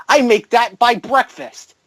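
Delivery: villain scoffing at the offer; smug rich villain. Retold or imitated. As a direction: toss it off like pocket change. Acting out Toby Jones quote.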